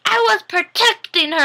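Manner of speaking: very high mock whine; frustrated little kid. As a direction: whine it like a thwarted small child. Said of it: "that's how he felt".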